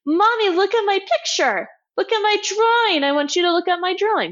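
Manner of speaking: put-on high, pleading little kid tugging at mom; child voice. Child begging mom to look.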